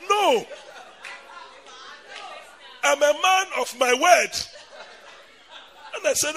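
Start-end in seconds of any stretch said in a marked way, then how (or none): 0.1-4.3 laughing. Retold or imitated. Brother teasing, laughing loud.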